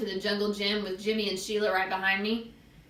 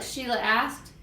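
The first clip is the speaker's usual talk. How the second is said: plain reading voice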